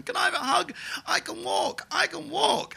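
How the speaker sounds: very high, joyful mock cries; gleeful. Woman in a wheelchair standing up, high gleeful cries. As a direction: squeal it with delight like a miracle. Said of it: "so glee-filled going".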